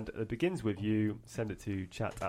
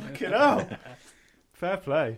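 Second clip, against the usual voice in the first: excited; 6 semitones higher, 9 dB louder, slower than usual. Real, not acted. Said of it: "reacting to the accent".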